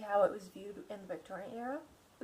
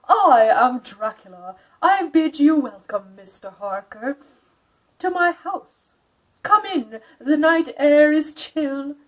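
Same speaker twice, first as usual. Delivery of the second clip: higher, louder, slow formal count; courtly Dracula; 6 semitones higher, 15 dB louder, slower than usual. Acted. Dracula formally welcomes Mister Harker, courtly and grand. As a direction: greet him grandly, hiding menace. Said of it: "He bowed in a courtly way".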